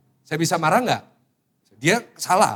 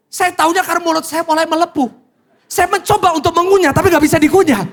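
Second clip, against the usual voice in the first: agitated retelling; 11 semitones higher, 7 dB louder than usual. Retold or imitated. Pastor reliving dry ice burning mouth.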